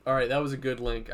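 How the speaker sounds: ordinary talk, nothing marked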